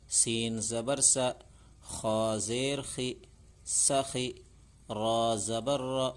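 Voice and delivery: slow, measured reading of each letter with its zer; slow Urdu reading drill; slow drill recitation. Shown as a demonstration. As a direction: chant it out for children to repeat, one syllable at a time.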